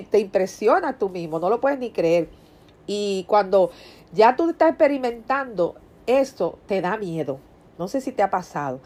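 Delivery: ordinary talk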